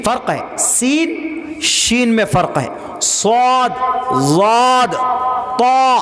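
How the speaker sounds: each letter said alone from its makhraj; teaching letter sounds. Shown as a demonstration. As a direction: contrast سین and شین clearly. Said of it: "آج قرآن سکھا رہا ہوں". (حروف کو مخرج سے الگ الگ ادا کرنا)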